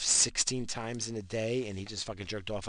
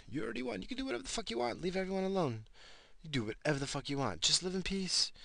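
much higher, lecturing voice; mock memo voice; 10 semitones higher than usual. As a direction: scold a billionaire in a high silly voice. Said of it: "send a memo to Bill Gates".

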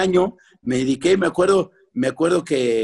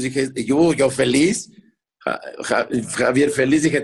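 ordinary talk, nothing marked

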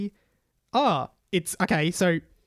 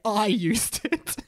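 slow, hammed-up proclamation with a higher lift; dramatic; 4 semitones higher, slower than usual. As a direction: draw it out for mock drama. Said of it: "rotated his head dramatically to say".

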